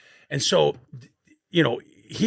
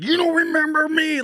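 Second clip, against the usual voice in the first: mock shouted taunt; 4 dB louder than usual. Retold attack, taunting the teammate.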